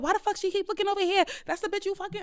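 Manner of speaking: high, mocking jealous girlfriend; mock angry girlfriend. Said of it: "She's like".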